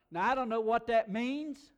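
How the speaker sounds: loud, high mock bafflement; comic exclamation. Real, not acted. Preacher joking about a huge number, loud and amused. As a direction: belt out the punchline to the crowd.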